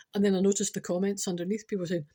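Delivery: usual speaking voice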